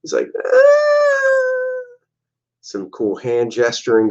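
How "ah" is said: a tiny, very high squeak of a whiner; very high whiny yelp. Acted. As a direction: squeal it like a sulking weakling. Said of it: "a whiny little bee".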